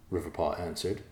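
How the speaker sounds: plain reading voice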